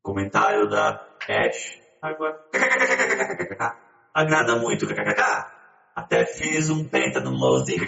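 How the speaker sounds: very deep slowed filtered voice; deep voice changer. Pretend. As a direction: read through a deep pitch-down filter. Reading comments with voice filter.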